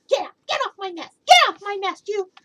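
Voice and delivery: high, fast angry shouting; angry yelling. Acted. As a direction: chase him off. Maisie yelling at Horton.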